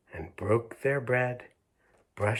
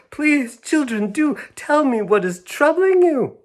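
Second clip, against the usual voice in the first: much higher, louder pleading teacher; anxious Miss Clavel; 9 dB louder than usual. Acted. Miss Clavel begging the girls to say what's wrong, high and loud.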